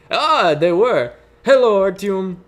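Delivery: loud, drawn-out, exclaimed greeting; effusive greeting. Acted. Cabin leader welcoming Artyom to the cabin, effusive. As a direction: greet him with big, rising stresses.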